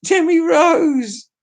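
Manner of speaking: Demi Rose shrieked high and slow through laughter; laughing exclaim. Shown as a demonstration.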